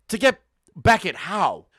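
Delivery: ordinary delivery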